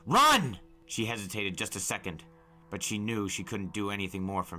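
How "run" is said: high, loud desperate shout; yell. Acted. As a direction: yell her away from danger. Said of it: "I yelled".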